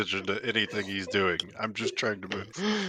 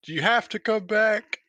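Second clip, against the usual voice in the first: shouted call, slow and high; yelling across; 10 semitones higher, 4 dB louder than usual. Acted. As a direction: yell it across the water. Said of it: "I'm gonna yell at Ken".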